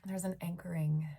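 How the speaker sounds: hushed meditation voice with long pauses; guided meditation. Real, not acted. Leader guiding awareness from head to feet, hushed meditation voice. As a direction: guide them through the body, leaving long silences.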